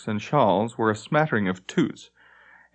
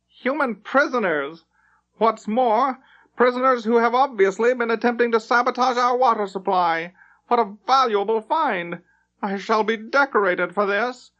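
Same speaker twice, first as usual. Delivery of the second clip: gleeful captain; 11 semitones higher than usual. Acted. Elf captain delighted by the captured humans, high and gleeful. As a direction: crow over the valuable find. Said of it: "had regained his good humor".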